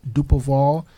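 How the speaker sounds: usual speaking voice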